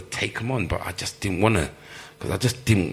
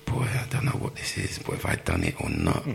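nervous whisper; 13 semitones lower, 7 dB quieter than usual. Retold or imitated. Nervous thought outside manager's office.

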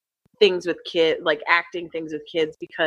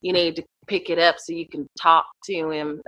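put-on Southern accent. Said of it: "we were doing Southern accents".

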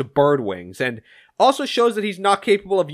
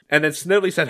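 usual speaking voice

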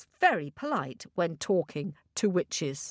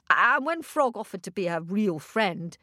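ordinary delivery

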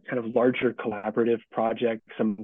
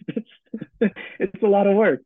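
higher, louder, laughing admission; 9 semitones higher, 5 dB louder, slower than usual. Real, not acted. Guest explaining why he's slowing down, laughing. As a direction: let a laugh break into the words.

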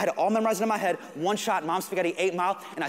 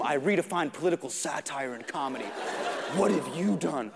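low, imposing mock-grand voice; Jon Stewart; 6 semitones lower, 3 dB quieter than usual. Giant shadow challenges nervous comic, low grand voice. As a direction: pronounce it low, looming over a newcomer. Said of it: "I can hear his accomplishments in each step".

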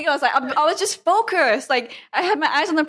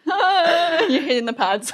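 ordinary talk, nothing marked

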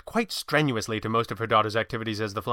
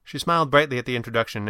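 ordinary delivery, nothing marked

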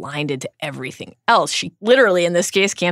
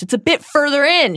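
loud and higher, calling out ahead; calling loudly; 9 semitones higher, 5 dB louder than usual. A narrator's reading. Hermione calling loudly to the hidden centaurs. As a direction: call it out so it carries through the trees. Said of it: "she calls loudly".

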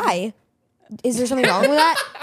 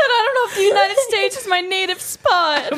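higher, laughing mimicry of his line; mocking quote; 7 semitones higher, 4 dB louder than usual. Sister mocks brother's garbled sentence, laughing. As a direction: parrot his words back, teasing. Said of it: "He said".

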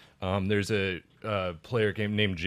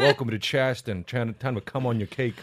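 put-on Charleston accent. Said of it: "this is a Charleston accent".

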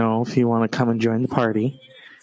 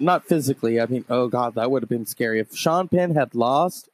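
ordinary talk

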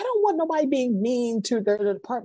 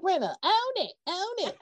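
coaxing; 10 semitones higher, 4 dB quieter than usual. Retold or imitated. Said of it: "Be like".

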